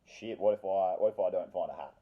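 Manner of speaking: ordinary talk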